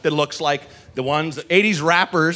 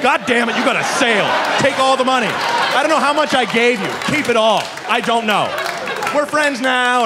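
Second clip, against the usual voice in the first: giddy excited gush; 8 semitones higher than usual. Retold or imitated. To the young skater, handing over money: high excited gush. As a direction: throw all your money at him, giddy. Overwhelmed buyer gushes at skater.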